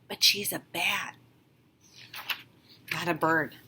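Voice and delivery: hushed whispered aside; whispering. A narrator's reading. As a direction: whisper it like a secret.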